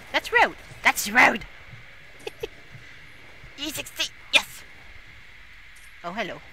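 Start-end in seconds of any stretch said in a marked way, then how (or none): 0.1-1.4 indignant protest. Real, not acted. Gamer scolding the enemy, loud indignant cry. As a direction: protest loudly at the attacker.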